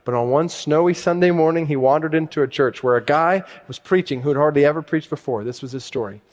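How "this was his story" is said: normal talking voice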